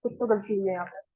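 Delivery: low, hushed thinking aloud; puzzling to oneself. Real, not acted. Teacher hunting own mistake at the board, quiet self-talk. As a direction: drop low and soft, searching for the slip.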